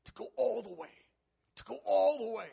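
high, loud preacher's cry; full-force rallying shout; emphatic exhortation. Real, not acted. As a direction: belt out the challenge, stronger on the repeat. Driving home the challenge.

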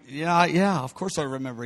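low, soft mumbled reply; sheepish admission. Acted. Peter reminded of his weakness, sheepish low mumble. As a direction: concede it reluctantly, sinking in volume. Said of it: "See Peter going".

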